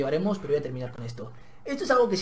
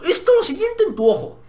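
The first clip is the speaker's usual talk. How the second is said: high, loud menacing shout; mock threat; 12 semitones higher, 8 dB louder than usual. Student threatens the bully over a snapped pencil, loud. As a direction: threaten him, over the top.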